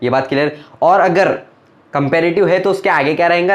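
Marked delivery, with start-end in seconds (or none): none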